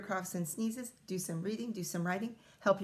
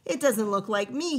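mildly raised indignant roar; a touch of an angry roar at the portrait; 2 semitones higher, 7 dB louder than usual. Acted. George roaring at the portrait, mild mock anger. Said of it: "he roared".

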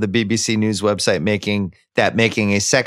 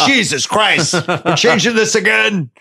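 higher-pitched mock exasperation; hammed-up weary complaint, pitched up; exasperated; 7 semitones higher than usual. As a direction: play an actor fed up with rewrites, drawn out. Acted exasperated actor at script change.

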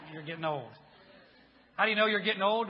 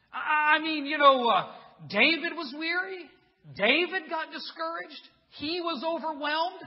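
high, loud disbelief; raised-pitch, astonished questioning; incredulous; 9 semitones higher, 7 dB louder than usual. Acted. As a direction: question it as if you can't believe it of your hero. Disbelief that his hero faltered.

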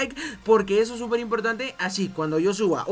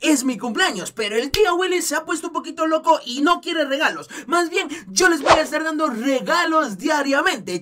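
kindergarten teacher voice; 5 semitones higher, 4 dB louder than usual. Acted. Addresses kids as chiquitines.

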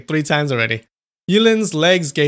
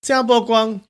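higher, loud call; yelling; 9 semitones higher, 5 dB louder than usual. A narrator's reading. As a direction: yell his name. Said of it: "Chu Feiyan yelled".

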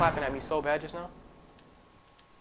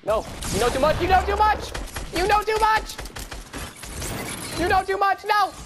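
frantic high yelling. Real, not acted. Frantic in fight.

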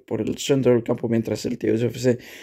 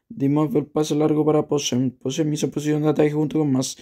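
ordinary talk

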